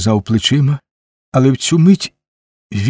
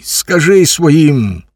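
higher, slow, insistent command; irritated, raised-pitch demand; angry order; 5 semitones higher, slower than usual. A narrator's reading. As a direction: order it crossly, drawing out each phrase. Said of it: "сердито кинув йому Куций".

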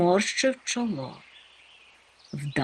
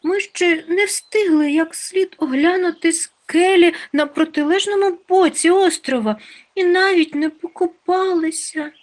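pleading little character; 12 semitones higher, 6 dB louder than usual. A narrator's reading. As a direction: beg in a squeaky little voice. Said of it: "попрохала Хропся". (благально, тоненьким високим голоском)

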